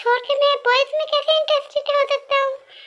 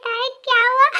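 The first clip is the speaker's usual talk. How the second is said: ordinary talk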